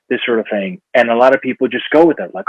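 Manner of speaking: ordinary delivery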